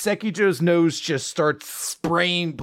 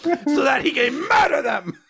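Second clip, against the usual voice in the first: booming spooky host; 7 semitones higher than usual. Pretend. Spooky host bellowing his rules to the podcast partner. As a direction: announce it like a haunted-house master.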